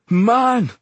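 high, loud burst; blurted outburst. A narrator's reading. Exhorter standing over his enemy with a knife, anguished blurt. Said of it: "Ross blurted".

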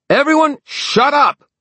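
very high, loud yell to silence a crowd. Acted. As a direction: bellow to silence the room. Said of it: "she yelled".

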